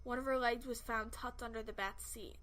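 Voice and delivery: usual speaking voice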